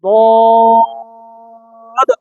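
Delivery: দত held long and slow; listen-and-repeat letter model; Arabic letter demo. Shown as a demonstration. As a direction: stretch দত so students can copy it. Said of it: "আমারটা শোনেন".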